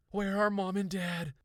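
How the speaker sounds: high, loud demand; frantic question. A narrator's reading. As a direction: urgently demand an answer. Frantic girl questioning her brother.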